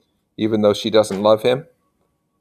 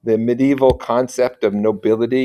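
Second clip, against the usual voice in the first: usual speaking voice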